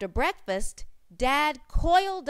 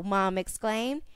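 usual speaking voice

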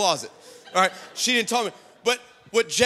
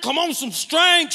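higher, insistent push on STRENGTH; urgent urging; 5 semitones higher, 4 dB louder than usual. Retold or imitated. Dad coaching his daughter before going live, urgent urging. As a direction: press hard on STRENGTH, pitched up.

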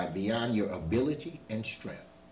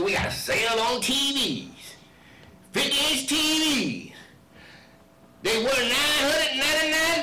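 lightly voiced hyped store announcer; store loudspeaker ad; 12 semitones higher, 6 dB louder than usual. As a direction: hawk the TV sale like a PA ad. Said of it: "they come over the speaker".

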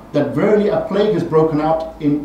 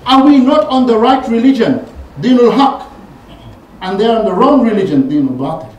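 loud, higher, insistent questioning; heated argument; 7 semitones higher, 7 dB louder than usual. Retold or imitated. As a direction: press every point like a grievance. Omar argues with the Prophet.

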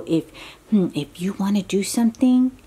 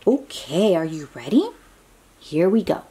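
ordinary delivery

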